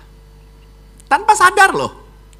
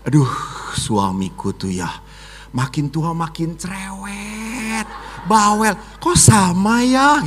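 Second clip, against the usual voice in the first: 1 gossiping wife; 5 dB quieter than usual. Retold or imitated. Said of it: "para istri pun kalau bicara".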